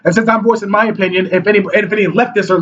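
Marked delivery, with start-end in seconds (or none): none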